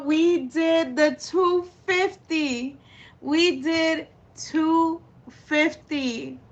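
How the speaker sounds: slow, drawn-out triumph breaking into a laugh; overwhelmed joy. Real, not acted. Host savors hitting the goal with viewers, overwhelmed with joy. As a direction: stretch every word in happy disbelief.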